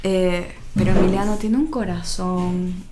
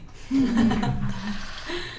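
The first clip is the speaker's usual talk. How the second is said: light rising laugh; amused laugh; 4 semitones higher than usual. Real, not acted. Mother laughing at her son's demand to God.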